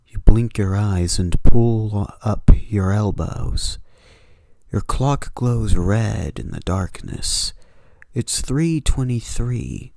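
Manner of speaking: steady horror storytelling read; story narration. A narrator's reading. Narrator setting a late-night horror scene, measured story read. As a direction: draw the listener into the dark room.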